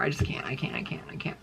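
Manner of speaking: whispered, fading out; soft whispered I can't, trailing off; exasperated whisper. Real, not acted. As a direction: breathe it out, defeated.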